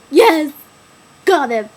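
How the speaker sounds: loud, high acted yell; urgent shout. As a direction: shout it as an emphatic demand. Acted urgent answer to guy.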